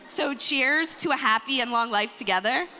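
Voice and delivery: ordinary talk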